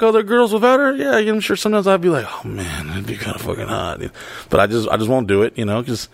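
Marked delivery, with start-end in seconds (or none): 2.3-4.0 soft, low tempted murmur; quiet tempted. Retold or imitated. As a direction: muse to yourself. Tempted inner thought.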